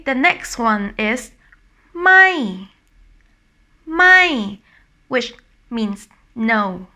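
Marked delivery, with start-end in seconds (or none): 1.9-4.6 "mai" starting high and loud, then falling. Shown as a demonstration. Teacher showing the word for no, falling tone "mai". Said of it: "it's falling tone".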